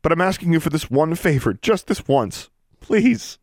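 high, loud, impassioned begging; desperate pleading. Acted. Girl begging her estranged sister to save a friend, desperate pleading. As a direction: plead urgently, pitched high and pushed hard.

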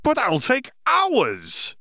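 a student's dismayed protest, pitched high; exclaiming. Acted. Older Ravenclaw student objects to Yaxley, exclaiming high. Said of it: "exclaimed one of the older Ravenclaws".

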